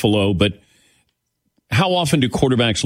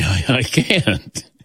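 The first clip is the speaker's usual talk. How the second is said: breathy laughing whisper, slow; 3 semitones lower, much slower than usual. Real, not acted.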